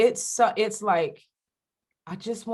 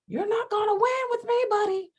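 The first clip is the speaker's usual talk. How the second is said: high, sing-out mock challenge; stubborn defiance. Replaying debates with her partner, high-pitched cocky defiance. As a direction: pitch it way up, refusing to lose. Acts out attitude in couple's debates.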